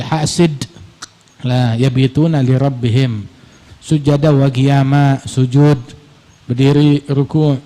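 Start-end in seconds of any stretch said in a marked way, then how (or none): none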